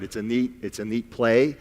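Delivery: normal talking voice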